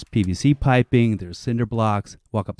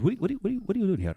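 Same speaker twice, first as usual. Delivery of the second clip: mock surprised official; 11 semitones higher, 5 dB quieter, faster than usual.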